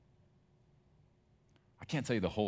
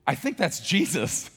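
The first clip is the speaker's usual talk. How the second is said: dawning realization; 12 semitones higher, 13 dB louder, slower than usual. Acted. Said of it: "who goes".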